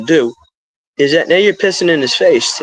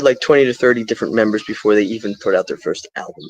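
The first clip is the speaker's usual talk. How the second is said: normal talking voice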